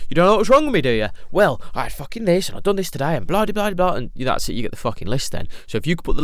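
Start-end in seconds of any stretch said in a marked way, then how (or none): 0.1-1.1 louder, higher mock angry retort; angry comeback. Partner snapping back at the accuser, angry. Acted quarrel between partners. 1.3-4.0 ranting list. Acted. Acted quarrel, listing grievances.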